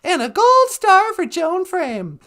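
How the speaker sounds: high, loud, drawn-out proclamation; excited award announcement. Real, not acted. Co-host rewarding a viewer for a donation, excited announcing. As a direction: proclaim the prize with delight, slowly. Awarding a donor a gold star.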